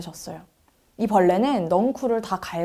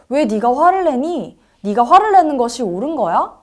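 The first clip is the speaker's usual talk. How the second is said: challenging question; 5 semitones higher, 5 dB louder than usual. A narrator's reading. God questions the angry Jonah.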